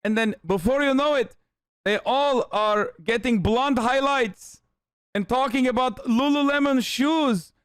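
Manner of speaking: put-on high-pitched comic Middle Eastern accent; indignant, hammed-up Middle Eastern accent; mock Taliban accent. Taliban recruiter ranting about women and Lululemon, mock Middle Eastern accent. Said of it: "The Taliban shows this compilation".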